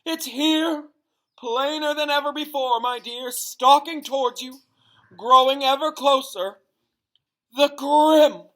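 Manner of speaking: high, loud, terrified proclaiming; frantic prophecy. A narrator's reading. The seer warning Harry of the Grim, shrieking in terror. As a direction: wail the omen in mounting dread. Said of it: "Professor Trelawney screamed".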